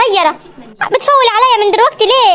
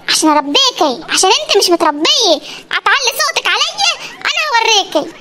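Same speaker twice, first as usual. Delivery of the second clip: usual speaking voice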